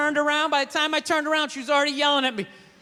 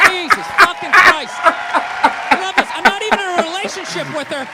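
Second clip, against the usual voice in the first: laughing exasperation; 6 semitones higher, 7 dB louder than usual. Real, not acted. Comedian laughing loudly in disbelief at the heckler.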